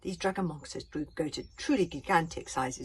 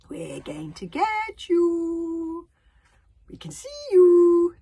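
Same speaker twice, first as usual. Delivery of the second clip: very slow, much higher and louder eerie chant; weird sing-song; 13 semitones higher, 15 dB louder, much slower than usual. A narrator's reading. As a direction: taunt like a swarm of singing monsters. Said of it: "in their weird sing-song, scary voices".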